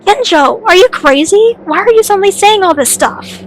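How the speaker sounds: shocked protest. Acted. Friend questioning Kenjo's sudden accusations, shocked. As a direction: protest in alarmed disbelief.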